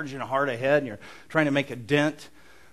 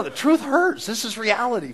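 emphatic preaching; 8 semitones higher, 8 dB louder than usual. Real, not acted. Preacher driving the point home.

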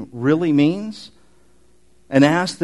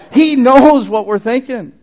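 louder, higher and slow, pressing the point home; emphatic slow declaration; 8 semitones higher, 11 dB louder, slower than usual. Real, not acted. Preacher to congregation, driving home that Jesus knows, slow and loud. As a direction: lean into every word, he knows. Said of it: "preacher stressing the point".

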